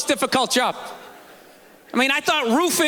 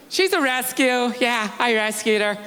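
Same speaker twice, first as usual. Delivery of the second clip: smug rescue owner. Retold or imitated. Quoting smug dog owners.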